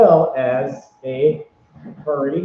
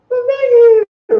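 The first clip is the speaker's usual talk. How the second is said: very high, louder squeal of thanks; 6 dB louder, slower than usual. Retold or imitated. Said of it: "in her Squeak voice".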